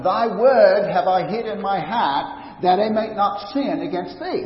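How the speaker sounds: put-on light Maine accent on the old verse. A pastor mimicking a Maine congregation's recital, light Maine accent. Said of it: "he can even say it in a Maine accent".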